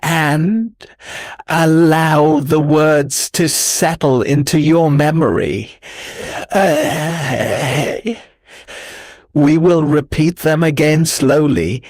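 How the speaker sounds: soothing sleep-learning narration; relaxation guide. Acted. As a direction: ease the listener into letting go. Leads a relaxation script.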